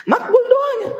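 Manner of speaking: high laughing echo of "Makbul doanya"; laughing repeat. Shown as a demonstration. Preacher repeating to the congregation, high and laughing.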